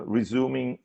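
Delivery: ordinary talk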